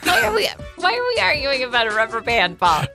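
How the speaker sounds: normal talking voice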